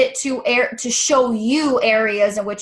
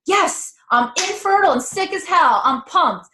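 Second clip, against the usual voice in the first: higher, hammed-up fake cheer; mock enthusiasm; 6 semitones higher than usual. Coach joking she isn't pumped about her struggles, mock enthusiasm. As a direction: pretend to be thrilled about bad news.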